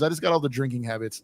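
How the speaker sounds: normal talking voice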